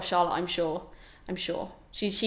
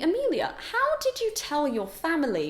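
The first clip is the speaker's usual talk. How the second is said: viewers asking; 6 semitones higher than usual. Pretend. Imagining viewers asking her.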